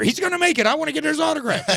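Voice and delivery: high-pitched mock fan squeal; excited fan. Woman hearing of X Factor tryout, high and excited. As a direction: imitate a woman bursting with eagerness.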